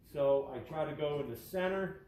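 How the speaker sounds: talking through a face mask; muffled voice behind a mask. Real, not acted. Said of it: "with my mask on".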